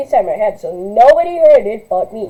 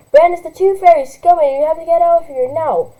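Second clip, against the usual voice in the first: louder, high urgent plea; urgent warning; 6 semitones higher, 4 dB louder than usual. Acted. Sister urging little sister to flee, loud. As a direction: scare her into moving.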